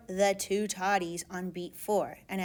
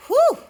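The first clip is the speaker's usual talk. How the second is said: breathless whew; 13 dB louder than usual. Real, not acted. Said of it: "I'm gonna need an oxygen mask after that".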